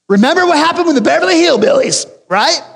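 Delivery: high, loud hammed-up teacher voice; preacher mock-voicing Gamaliel's Beverly Hillbillies warning; joking Gamaliel quote. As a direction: ham it up as Gamaliel for a laugh. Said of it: "it sounds like Beverly Hillbillies".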